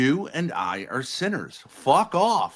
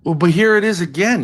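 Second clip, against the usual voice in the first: much louder, fed up; exasperated loud; 4 semitones higher, 9 dB louder, faster than usual. Real, not acted. Fed-up partner loudly cuts in during the argument. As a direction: burst out in frustration.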